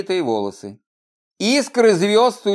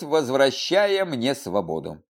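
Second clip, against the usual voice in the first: usual speaking voice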